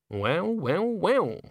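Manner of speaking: knowing, self-directed musing; musing to herself. A narrator's reading. Witch reflects on the overheard singer, musing. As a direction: let it climb as the discovery sinks in. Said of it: "more or less to herself".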